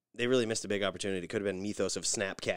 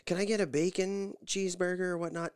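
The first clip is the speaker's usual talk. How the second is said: higher, airy mock voice of his wife ordering; imitating Nikki ordering; 10 semitones higher than usual. Wife orders through the drive-thru speaker, mock-high and sweet. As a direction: play her sweet and polite. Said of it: "She's just like".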